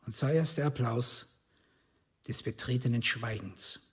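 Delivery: normal talking voice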